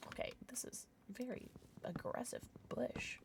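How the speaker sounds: breathy whispered aside. Real, not acted. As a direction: whisper it to yourself. Aside while playing.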